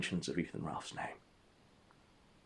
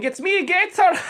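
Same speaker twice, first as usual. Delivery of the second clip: very high, loud hammed-up fake Mexican accent; 10 dB louder than usual. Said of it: "with a fake Mexican accent".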